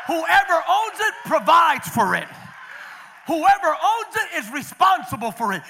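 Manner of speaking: loud, high, shouted sermon climax; rally the church with big shouted declarations; preaching at full force. Acted. Pastor rallying parents to dedicate everything to God, shouted preaching.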